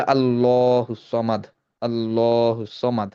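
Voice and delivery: আল্লাহুস সামাদ with a clear ending দ, slowly; slow careful আল্লাহুস সামাদ, ending on a firm d; correct pronunciation. Shown as a demonstration. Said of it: "এটা হবে আসলে".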